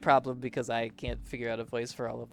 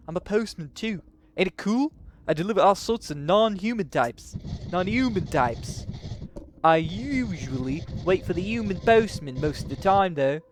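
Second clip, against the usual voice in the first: higher, louder mock-Liverpool postman; 4 semitones higher, 7 dB louder than usual. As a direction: badly fake a Liverpool accent, pitched up and loud. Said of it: "he's gonna sound like [name]".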